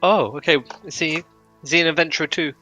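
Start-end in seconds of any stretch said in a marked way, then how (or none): none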